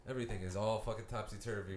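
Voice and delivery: usual speaking voice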